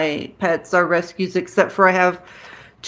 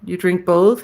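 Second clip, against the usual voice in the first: normal talking voice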